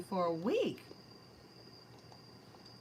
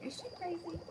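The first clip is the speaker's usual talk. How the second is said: higher, soft puppy voice; voicing the puppy; 8 semitones higher, 8 dB quieter than usual. Reader voices her puppy's doubtful thought. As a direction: say it as the dog's quizzical look. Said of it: "My puppy's looking at me like".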